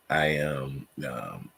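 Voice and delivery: ordinary talk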